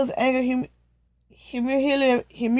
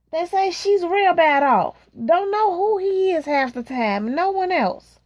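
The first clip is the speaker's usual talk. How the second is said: lightly voiced gossiping friend; 6 semitones higher than usual. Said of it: "gossiping friend".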